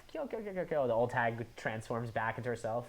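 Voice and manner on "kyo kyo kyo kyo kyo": high breathy hag cackle. Retold or imitated. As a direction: cackle like an old crone. Old hag cackle in retelling.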